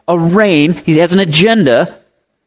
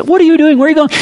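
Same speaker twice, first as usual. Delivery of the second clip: surprised call; 11 semitones higher, much faster than usual. Retold or imitated. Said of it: "You say".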